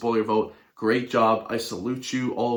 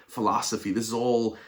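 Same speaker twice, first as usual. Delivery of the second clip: ordinary talk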